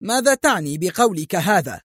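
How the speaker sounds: police chief insulted, angrily demanding; angry shout. A narrator's reading. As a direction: demand an answer in anger. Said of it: "صائحا في غضب".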